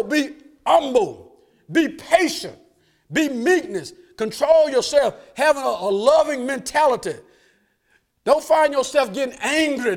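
loud, high preaching push, the congregation rising with him; fiery preaching. Acted. Preacher urging the church to let it go, full-force preaching. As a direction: drive it home like a revival preacher.

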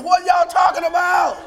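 high, loud cry of disbelief; shocked teacher. Retold or imitated. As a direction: shout the retold question in astonishment. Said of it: "I was shocked".

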